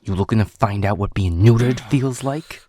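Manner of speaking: loud, angry threat with rising pitch; menacing threat. Acted. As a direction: threaten them at full volume. Threatens captive with violence.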